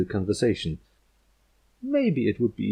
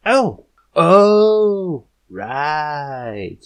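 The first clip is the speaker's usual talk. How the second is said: much higher, loud dawning oh-oh; sudden realization; 7 semitones higher, 9 dB louder, much slower than usual. Acted. Rainbow Dash catches on, suddenly thrilled. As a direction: get it all at once.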